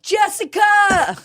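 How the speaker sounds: slow, high, loud call. Retold or imitated. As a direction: yell her name across the lot. Friends calling out to her.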